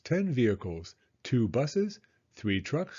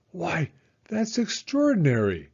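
amazed, raised exclamation; excited cry; 5 semitones higher than usual. Acted. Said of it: "cried the mayor".

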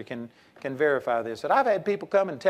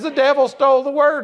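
higher, louder, distressed whine; imitating an upset student; 8 semitones higher, 6 dB louder than usual. Imitating the weeping student complaining about talking women.

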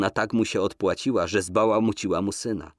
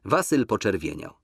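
ordinary delivery, nothing marked

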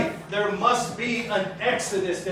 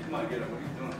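hushed, much softer and lower wife's urging; imitating his wife; 9 semitones lower, 12 dB quieter, much faster than usual. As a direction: quietly nudge him to get up.

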